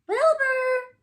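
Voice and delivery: high, loud call; shouting Wilbur. A narrator's reading. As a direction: shout his name up high. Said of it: "shouted Francine".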